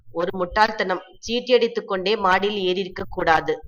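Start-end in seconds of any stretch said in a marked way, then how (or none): none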